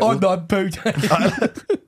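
put-on slow, laughing, mangled Canadian accent; mock Canadian accent. Mocking a friend's bad try at a Canadian accent. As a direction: drag it out as a bad Canadian parody. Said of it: "worst Canadian accent".

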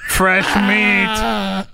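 creepy creature voice, drawn out; leering manatee. Acted. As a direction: leer like a hungry sea cow. Voicing lecherous manatees.

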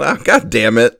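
loud, high-pitched comic dismay; mock exasperated outburst. Host imagines being coach hearing job news, loud mock exasperation. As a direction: yell it as the kicked-himself coach.

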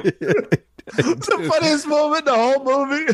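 laughing while praising the scene; high, laughing delight. Real, not acted. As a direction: talk through real laughter.

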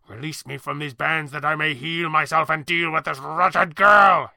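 a bound villain demanding his release; haughty demand. Acted. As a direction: demand it, rising to a shout on girl. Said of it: "he demanded again".

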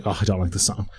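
low, soft bored grumble. Acted. Joke teller voicing a bored driver, lightly bored. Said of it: "he's kinda bored".

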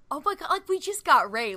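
high, excited gush; retold excitement. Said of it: "I kind of felt like".

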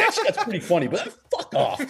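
half-grin, then tell him off; laughing. Retold or imitated. Teammate forgiving the prank, laughing. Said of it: "He's like".

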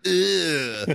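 high, put-on drunk "Ew"; drunk girl voice. Drunk lance corporal flashing the new sergeant, high and mocking. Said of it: "is like".

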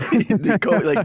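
laughing through the words; giggling as you say it. Real, not acted. Laughing reply.

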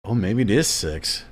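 ordinary talk

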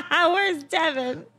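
high, drawn-out customer whine; mock whiny disappointment. Disappointed table asking for their old waiter, mock whine. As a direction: imitate a let-down customer, stretched and high.

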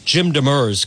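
usual speaking voice

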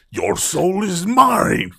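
second take of an overacted villain threat; hammy menace, slightly reined in; menacing, barely rolled back. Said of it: "maybe roll it the fuck back, like, two pegs".

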